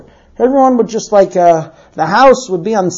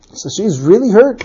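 ordinary delivery

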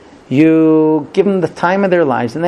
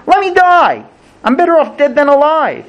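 begging; 10 semitones higher, 5 dB louder than usual. Acted. Yonah begs to die.

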